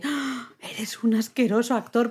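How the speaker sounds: high gasp, then a disgusted insult; gasp, then sneer out the line; mock disgust. Retelling the film's insult. (asco fingido tras un grito agudo)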